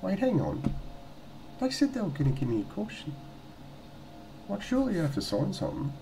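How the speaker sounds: high-pitched, puzzled inner voice; puzzled thinking. Retold or imitated. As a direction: retell your own baffled thought, pitched well up. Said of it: "just thinking".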